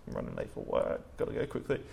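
rushed, breathy aside; hurried whisper. Retold or imitated. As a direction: brush someone off in a hurry. Retold hurried brush-off.

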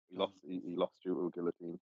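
ordinary talk

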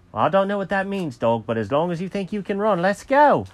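high, quick character voice; Jenkins the cat, hurried. Acted. Cat urges Alfred to run for the plane, high and hurried. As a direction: rattle it off high, rallying the dog.

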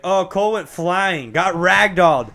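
excited retelling. Retold or imitated. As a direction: tell it breathlessly urgent. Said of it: "He's like".

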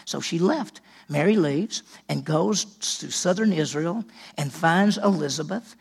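usual speaking voice

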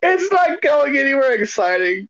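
high, loud laughing echo of the line; mocking echo. Friend mockingly repeats the dull story line, loud and laughing. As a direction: throw his own words back at him, cracking up.

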